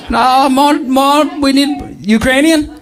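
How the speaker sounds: put-on Ukrainian accent. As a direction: try a Ukrainian accent high up, unsure of it. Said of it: "no one can do a Ukrainian accent".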